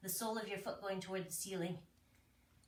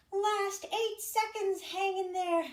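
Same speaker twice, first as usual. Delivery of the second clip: encouraging; 7 semitones higher, 3 dB louder, slower than usual. Real, not acted. Coach urging final seconds.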